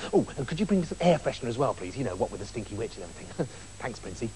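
low, soft, quick afterthought; offhand quiet aside. A narrator's reading. Rapunzel asks the prince a favour, soft quick aside. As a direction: toss it off softly and quickly.

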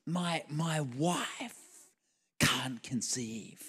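slow, soft, halting heartbreak; imitated tearful confession. Waiter confiding in the pastor, slow and tearful. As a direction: draw each word out softly, mock tearful.

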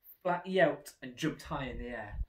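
ordinary delivery

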